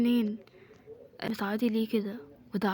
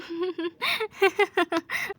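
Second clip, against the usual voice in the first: high-pitched giggle; laughing; 8 semitones higher than usual. Acted. Women laugh after mother's scolding of Yasmin, giggling high.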